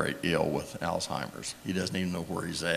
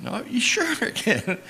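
playful mock high. Speaker playfully insists on paying.

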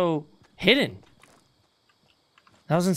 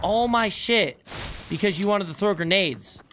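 loud frustrated yelling at teammate; angry yelling; 6 semitones higher, 4 dB louder than usual. Real, not acted. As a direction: yell it out, fed up. Teammate lost his loot.

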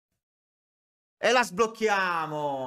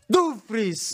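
breathless italian goal commentary; 8 semitones higher than usual. Real, not acted. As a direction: celebrate the third goal at full voice. Third goal celebration.

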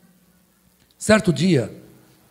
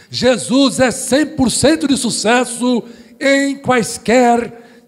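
preaching at full force; 5 semitones higher, slower than usual. Acted. Preacher to the church, building to a fervent climax. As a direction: build the congregation up to a fervent peak.

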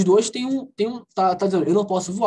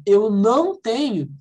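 ordinary talk

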